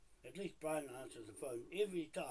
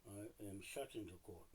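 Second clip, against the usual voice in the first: softer, spelled out for the phone; 6 semitones lower, 8 dB quieter than usual. Real, not acted. Dictating a message into phone.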